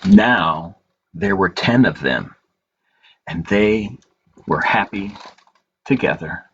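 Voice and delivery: put-on slow, gentle read-aloud for children; story narration. A narrator's reading. Librarian reading a picture book to children, gentle storytelling.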